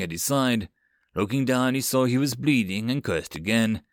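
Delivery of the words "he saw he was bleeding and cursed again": plain reading voice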